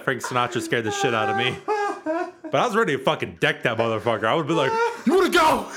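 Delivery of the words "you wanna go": mock challenge shout. Ready to deck the cutout.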